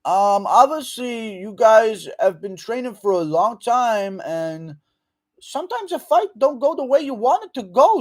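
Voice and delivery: hammed-up reporter fumbling a question; UFC reporter impression. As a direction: ask like a rambling dim reporter. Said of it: "U F C reporter asking stupid question impression".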